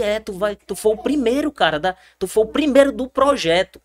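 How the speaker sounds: lifted voice, lightly acted excitement; retold excited coach. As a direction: mimic a coach confirming great news. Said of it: "Ele disse".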